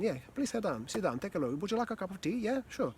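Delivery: high, chirpy greeting; matching head voice. Shown as a demonstration. Coach matches a new client on meeting, high head-voice greeting. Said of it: "is to match them".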